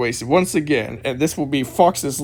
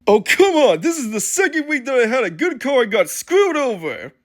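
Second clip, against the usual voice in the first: higher-pitched acted complaint; frustrated outburst; 7 semitones higher than usual. Driver vents after a wreck.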